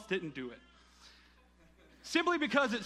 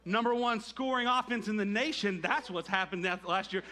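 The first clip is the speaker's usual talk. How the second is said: ordinary talk, nothing marked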